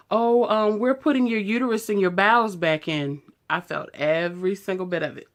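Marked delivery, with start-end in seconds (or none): 0.1-3.2 lightly mimicked staff, pitched higher; a touch of put-on voice; imitated staff reply. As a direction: mimic the staff answering, a bit higher and louder. Acts out staff answering her.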